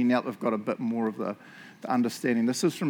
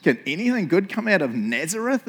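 raised-pitch mock disbelief; incredulous exclamation; 6 semitones higher, 3 dB louder than usual. Nathanael doubting news about Jesus, acted incredulity. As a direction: scoff at the very idea, pitch lifted. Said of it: "exclaimed Nathanael".

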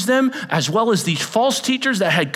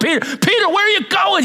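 calling out; 12 semitones higher, 4 dB louder than usual. Acted. Disciples calling out to Peter.

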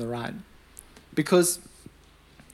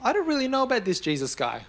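higher-pitched, doubtful hedging; in two minds; 8 semitones higher than usual. Retold or imitated. Preacher imagining the betrayer sizing up Jesus, doubtful. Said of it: "probably already in two minds".

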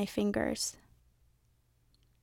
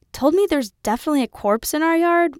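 higher, louder outraged father; dad complains about pizza guy, higher indignant voice; 6 semitones higher, 6 dB louder than usual. A narrator's reading.

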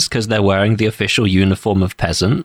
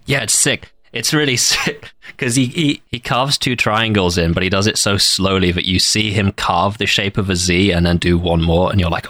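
normal talking voice

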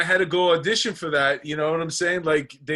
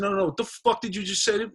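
higher-pitched retort to a challenge; acted confrontation; 6 semitones higher, faster than usual. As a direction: replay squaring up to someone, pitch raised. Retold quarrel with the bouncer.

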